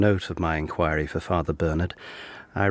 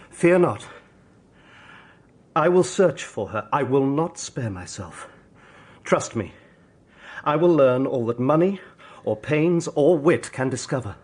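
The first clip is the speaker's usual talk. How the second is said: fervent vow; 6 semitones higher than usual. Acted. Hero vows search to grieving mother.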